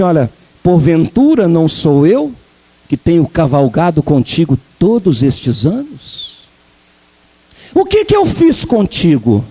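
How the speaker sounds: low, slow, posh-sounding donkey pleading; refined donkey. Pretend. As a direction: speak like an Oxford-educated donkey. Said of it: "falou assim de uma forma tão fina".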